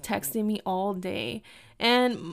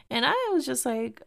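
normal talking voice

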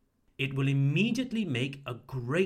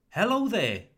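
"Hello there" with a high, loud HELLO falling away; warm greeting intonation; 6 semitones higher, 5 dB louder than usual. Shown as a demonstration. English teacher models a greeting for learners, warm intonation. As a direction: greet warmly, "Hello there" starting high then dropping. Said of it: "Try to use my intonation".

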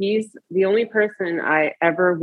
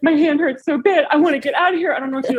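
put-on panicked boyfriend; 8 semitones higher, faster than usual. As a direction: play him freaking out in pain, hammed-up. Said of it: "he's like".

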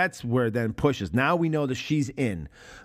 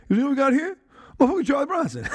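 much higher, louder mocking gangster voice; imitating Clarence's pimp; 11 semitones higher, 3 dB louder, much faster than usual. Retelling the film scene to a friend, mocking high pimp voice. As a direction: ham up the pimp's sneer. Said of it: "He's like".

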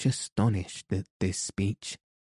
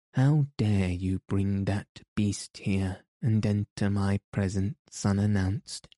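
plain reading voice

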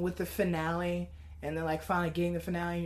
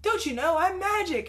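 high, showy boast; boastful cartoon character; 9 semitones higher, 3 dB louder than usual. Jake showing off to his friend, high and boastful. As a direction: show off with a raised pitch.

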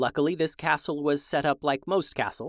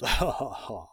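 villain chuckle; 4 semitones lower than usual. Acted. Villain laughing after threat.

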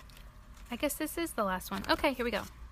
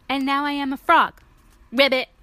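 proud loud declaration; 4 semitones higher, 10 dB louder than usual. Acted. Piggy proudly declares being frog.

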